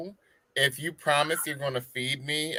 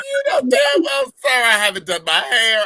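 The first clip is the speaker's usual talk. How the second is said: much higher, louder mock squeal; squealing protest; 7 semitones higher, 5 dB louder than usual. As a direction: squeal it at the delivery man. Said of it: "I'm like".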